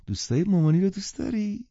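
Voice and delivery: put-on high, loud baby-talk question; doting mother. A narrator's reading. Mother showing her child off to guests, doting. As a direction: ask it in a cooing singsong.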